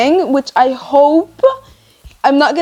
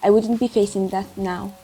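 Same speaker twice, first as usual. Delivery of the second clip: soft, even audiobook reading; solemn story narration; 3 semitones lower, 6 dB quieter than usual. Acted. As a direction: read the preface quietly and gravely. Reads the book's preface aloud.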